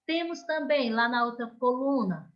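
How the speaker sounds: normal talking voice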